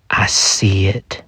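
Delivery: slow suspenseful whisper; drawn-out, hushed reveal; hushed dread. Acted. Suspense moment in the story.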